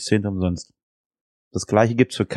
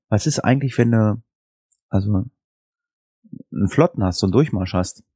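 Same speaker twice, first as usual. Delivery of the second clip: ordinary talk, nothing marked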